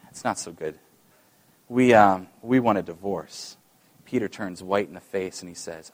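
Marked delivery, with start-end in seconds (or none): 0.1-3.6 mock gloomy confession; dismal. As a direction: say it dismally, deflated. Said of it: "look at him kind of dismally".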